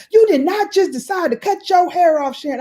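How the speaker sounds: put-on high scolding child voice; imitating niece.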